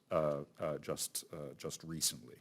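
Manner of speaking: normal talking voice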